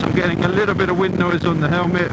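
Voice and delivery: talking over wind inside a helmet. Real, not acted. Riding at seventy in a helmet.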